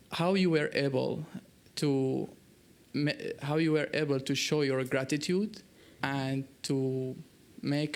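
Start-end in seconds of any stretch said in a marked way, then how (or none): none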